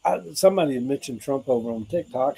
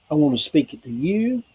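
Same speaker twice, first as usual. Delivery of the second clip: ordinary talk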